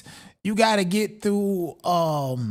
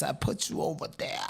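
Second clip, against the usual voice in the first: breathy near-whisper, much softer, almost secretive; half-whispered aside; 4 semitones lower, 9 dB quieter than usual. Real, not acted. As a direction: drop it to a sly whisper as an aside. Gleeful aside repeat.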